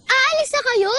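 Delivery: high, loud startled question; gulat na tanong. Acted. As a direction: blurt it out, caught off guard by the leaving. Learns the friend is leaving. (biglang tanong na mataas at malakas)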